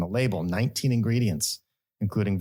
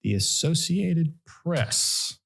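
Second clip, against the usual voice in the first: slow, grand segment intro; announcer voice; 6 semitones higher than usual. Acted. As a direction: present it like a radio host.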